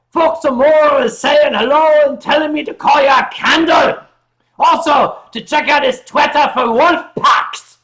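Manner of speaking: loud, high mock Scottish shout. Streamer reading a viewer's message in a shouted Scottish accent. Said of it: "Scottish is not just screaming".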